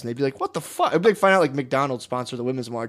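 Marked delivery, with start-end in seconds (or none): 0.4-0.9 high, stunned what the fuck; shocked. Retold or imitated. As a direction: blurt it out in disbelief. Imitating confused protesters.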